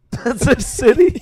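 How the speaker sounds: high, loud, slow laughing words; incredulous through real laughter; amused disbelief. Real, not acted. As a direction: crack up at the mistake. Laughing at wrong answer.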